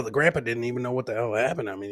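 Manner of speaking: ordinary talk, nothing marked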